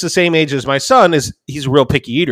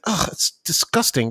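higher, softer, recoiling groan; imitated disgust; 4 semitones higher, 8 dB quieter than usual. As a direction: mimic a grossed-out sister. Imitating grossed-out sister.

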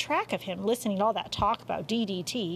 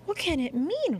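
worried; 8 semitones higher than usual. A narrator's reading. Worried wife questions her husband.

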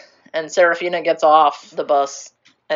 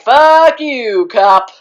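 mock yell; 6 semitones higher, 6 dB louder, much slower than usual. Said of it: "they're like".

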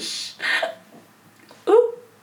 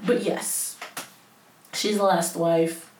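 ordinary delivery

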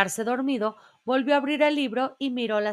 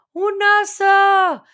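a much louder, much higher scream, drawn out slowly; panicked shout; 10 semitones higher, 10 dB louder, slower than usual. A narrator's reading. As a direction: yell in alarm at the bear. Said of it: "gritó alguien". (grito de pánico, muy fuerte y agudo)

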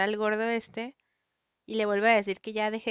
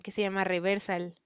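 usual speaking voice

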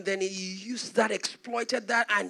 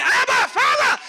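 high, loud call; crying out; 9 dB louder, slower than usual. Retold or imitated. Preacher quoting the cry of adoption, loudly calling out. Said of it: "whereby you cry".

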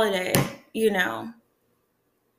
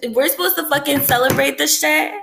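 higher-pitched, indignant rhetorical question; incredulous; 4 semitones higher than usual. Real, not acted. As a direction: throw it out in disbelief. Incredulous rant about celebrating Christmas.

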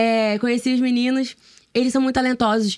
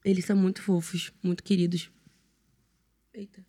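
hungover, voice nearly gone; rough, hoarse morning-after voice; 5 dB quieter than usual. Real, not acted. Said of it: "eu não tinha voz".

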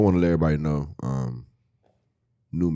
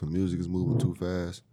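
usual speaking voice

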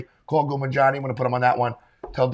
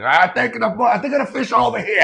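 put-on higher Italian-American dad accent; old Italian father, pitched up; 8 semitones higher than usual. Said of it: "He does a great Italian accent".

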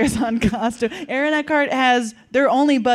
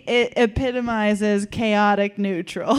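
normal talking voice

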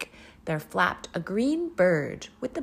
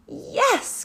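high, happy yes; cried yes; 4 dB louder than usual. A narrator's reading. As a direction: cheer it out. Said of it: "cried the witch".

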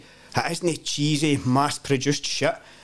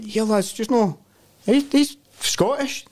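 mock disbelief; 9 semitones higher, slower than usual.

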